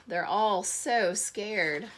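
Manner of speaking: usual speaking voice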